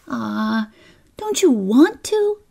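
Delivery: put-on high little-girl coaxing; pleading child. Kezia asks reluctant Lil, high and pleading.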